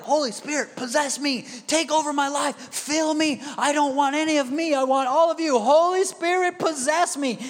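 high, loud, building plea; mock fervent prayer. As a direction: imitate an overwrought believer crying out to be filled. Imitates fervent believers begging in prayer.

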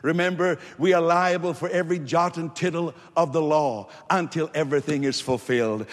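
ordinary talk

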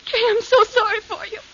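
sobbing lament; crying. Acted. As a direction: weep out your pity for her. Edna breaks down weeping.

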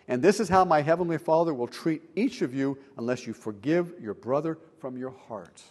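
normal talking voice

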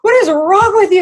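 high, loud upset exclamation; hammed-up teary disbelief; mock tearful outburst. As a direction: imitate a weepy boyfriend's indignation. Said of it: "in tears".

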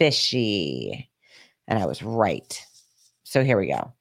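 lightly hammed-up sinister hint; mock ominous voice. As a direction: drop low and dramatic, like a trailer teaser. Ominous tease before playing clip.

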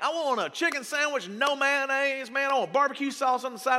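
mock fussy order. A customer placing a picky sandwich order, in mock fuss. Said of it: "I'm like".

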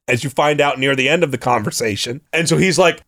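usual speaking voice

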